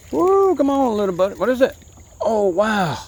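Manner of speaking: very high, loud thrilled cheer; excited whoop. Real, not acted. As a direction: cheer the fish in. Reeling in a fish.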